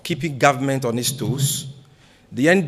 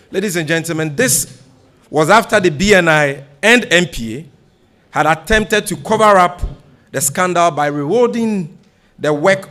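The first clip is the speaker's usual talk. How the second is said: louder and higher, pressing hard on each phrase; forceful denunciation; 4 semitones higher, 6 dB louder than usual. Real, not acted. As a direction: hammer the accusation home to the press. Denouncing the scandal at a press conference.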